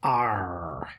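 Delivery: light pirate arr, played for kids; playful hint of a pirate. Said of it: "Hope you like my pirate impression".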